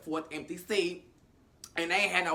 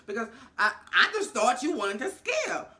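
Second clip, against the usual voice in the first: higher, louder, laughing through the words; 6 semitones higher, 4 dB louder than usual. Real, not acted. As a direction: crack up while admitting the mix-up. Laughing at her own mistake.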